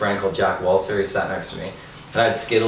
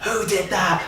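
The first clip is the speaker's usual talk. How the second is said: teacher's sharp question; 11 semitones higher, slower than usual. Retold or imitated. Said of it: "she's just like".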